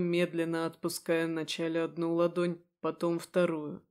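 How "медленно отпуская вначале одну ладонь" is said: plain reading voice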